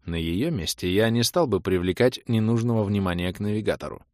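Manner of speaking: usual speaking voice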